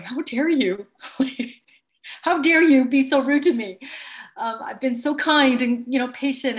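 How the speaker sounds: hammed-up "how dare you", half laughing; mock indignation. Coach replays her own inner outrage at a rude client, mock indignant. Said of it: "I could just feel myself going".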